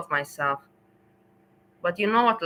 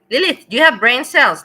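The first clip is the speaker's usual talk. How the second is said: loud mocking call; 4 semitones higher, 10 dB louder than usual. Real, not acted. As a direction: shout the name and jeer. Calling out a chatter.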